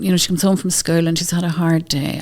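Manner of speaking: ordinary talk, nothing marked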